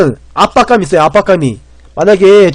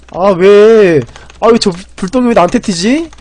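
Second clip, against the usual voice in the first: usual speaking voice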